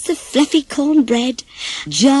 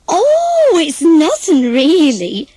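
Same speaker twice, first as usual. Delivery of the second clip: brushing it off; 6 semitones higher, 6 dB louder, slower than usual. Acted. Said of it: "said Peter".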